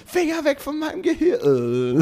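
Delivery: very high squeaky indignant cry; outraged gadget voice. Retold or imitated. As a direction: squeal it like an offended cartoon machine. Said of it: "und dann so".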